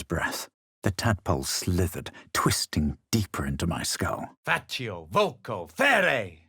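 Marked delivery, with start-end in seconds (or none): 4.4-6.4 incantation. Acted. Spell incantation in battle.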